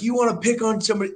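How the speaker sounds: squaring up to a teammate; quick fired-up challenge; fast angry challenge. Retold or imitated. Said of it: "I'm like".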